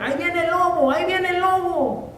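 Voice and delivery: high prank cry of a fake alarm; mock shout. Shepherd boy fooling the village again, high fake alarm. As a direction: call out the wolf just to fool them. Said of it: "empezó nuevamente a gritar".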